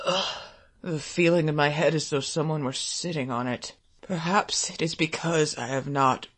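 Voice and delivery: low, soft, fading to breath; father muttering in shame at night. A narrator's reading. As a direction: mutter wearily, almost whispering. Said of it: "he was muttering".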